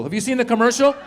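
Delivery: ordinary delivery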